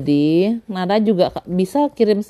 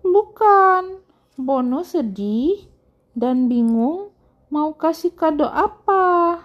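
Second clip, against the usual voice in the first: put-on high, slow girly character voice; Bono's delicate high singsong; girlish voice; 10 semitones higher, slower than usual. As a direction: draw it out in a high girlish storybook voice. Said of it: "jadi kayak cewek suaranya".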